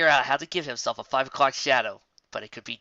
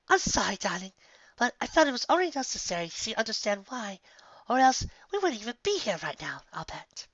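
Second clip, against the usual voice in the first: higher, sheepish lady voice; Rarity, embarrassed; 9 semitones higher than usual. Acted. Rarity sheepishly explaining herself to Applejack, higher. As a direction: apologize sweetly, a little sheepish.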